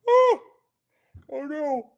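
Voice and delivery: sudden high oh, then oh no; surprised oh no. Real, not acted. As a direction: caught off guard, high yelp then a groan. Notices something on camera.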